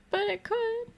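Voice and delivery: very high, wishful pleading voice. Pretend. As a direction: squeak it out, hoping it fits. Pleading over a puzzle piece.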